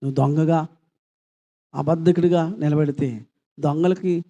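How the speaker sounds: ordinary talk, nothing marked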